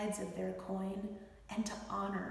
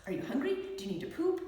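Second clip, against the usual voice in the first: higher, brisk mock diagnostic mother; rapid-fire checklist questions, a touch louder; mom doctor mode; 7 semitones higher, 4 dB louder, faster than usual. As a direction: quiz the child like a mom doctor. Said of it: "I'd go into mom M D mode".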